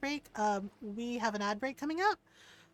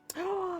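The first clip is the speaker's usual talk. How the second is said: gasp; 7 semitones higher than usual. Real, not acted. Reacting to raider's news.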